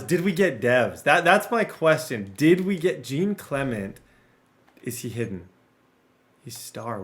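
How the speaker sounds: usual speaking voice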